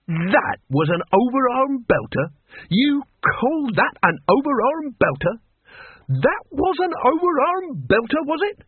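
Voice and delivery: mock the feeble attempt, rising each time; scornful disbelief. A narrator's reading. Boggis mocks the boy's blow.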